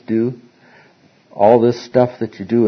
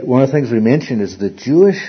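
usual speaking voice

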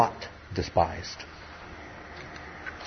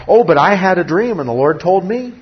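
high, louder pleading excuse; higher protesting voice; mock Joseph; 8 semitones higher, 6 dB louder than usual. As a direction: play Joseph insisting on his dream.

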